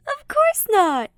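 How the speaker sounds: high, loud, dramatic denial; dramatic gasp. Acted. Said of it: "he gasps dramatically".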